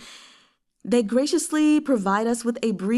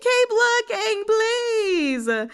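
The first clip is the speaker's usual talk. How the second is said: high-pitched whiny plea, louder; pleading high voice; 5 dB louder, slower than usual. Retold or imitated. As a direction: beg in a squeaky high voice. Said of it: "And I was like".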